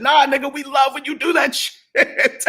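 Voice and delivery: gleeful mock-laughing quote; imitating laughing friends. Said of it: "started laughing and said".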